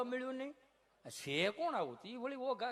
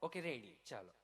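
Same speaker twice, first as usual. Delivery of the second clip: ordinary talk, nothing marked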